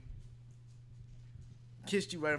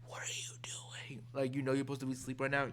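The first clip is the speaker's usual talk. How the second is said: whisper yelling; 3 semitones lower, 6 dB quieter than usual. Shown as a demonstration. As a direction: scold without waking anyone. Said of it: "something you do when you're trying not to wake somebody up".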